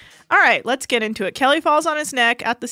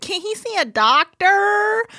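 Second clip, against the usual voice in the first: mock Amy; 9 semitones higher, much slower than usual. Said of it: "and then she's like".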